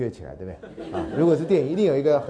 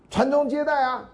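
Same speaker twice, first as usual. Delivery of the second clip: much higher, slow, righteous declaration; self-assured; 11 semitones higher than usual. Retold or imitated. Parent confidently answering why she married, self-righteous. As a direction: proclaim it as if it were obvious. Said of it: "理直气壮啊".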